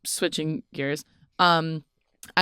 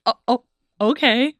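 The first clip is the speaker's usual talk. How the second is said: mock timid voice, a bit higher and louder; timid okay; 6 semitones higher, 5 dB louder than usual. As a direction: sound caught off guard and meek. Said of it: "And I literally was like".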